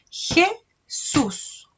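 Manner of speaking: Jesús said high and clear, syllable by syllable; teacher sounding out Jesús; syllable demo. Shown as a demonstration. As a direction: sound out Jesús slowly for children. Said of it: "yo digo".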